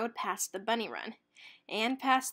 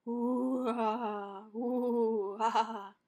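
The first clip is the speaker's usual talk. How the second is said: monster moans; much slower than usual. A narrator's reading. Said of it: "loud moans".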